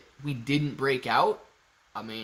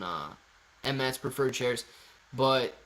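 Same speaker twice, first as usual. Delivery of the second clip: normal talking voice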